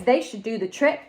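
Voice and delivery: ordinary delivery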